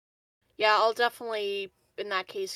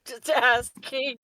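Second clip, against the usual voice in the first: slow, drawn-out innocent just asking, louder; innocent in-character ask; 4 semitones higher, 7 dB louder, much slower than usual. Acted. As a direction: play it coy and innocent, stretching the words. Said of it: "but in character".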